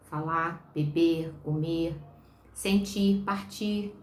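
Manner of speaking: usual speaking voice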